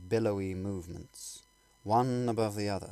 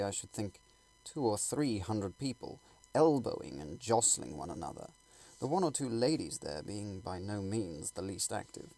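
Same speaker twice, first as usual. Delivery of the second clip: usual speaking voice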